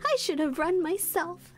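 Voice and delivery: ordinary delivery, nothing marked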